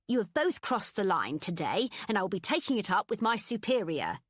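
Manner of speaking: prim, threatening boss voice; a strict manager laying down the law; stern boss. A narrator's reading. Boss threatens to report them.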